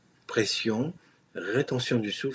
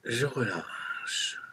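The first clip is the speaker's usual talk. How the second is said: slow release; much slower than usual. Shown as a demonstration.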